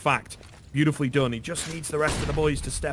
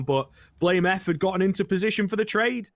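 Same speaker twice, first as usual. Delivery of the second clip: normal talking voice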